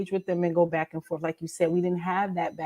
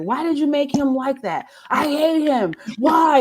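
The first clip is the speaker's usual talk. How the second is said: higher, indignant reader's protest; mock mad; 7 semitones higher, 5 dB louder than usual. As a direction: imitate a reader wailing in outrage. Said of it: "mad at my characters".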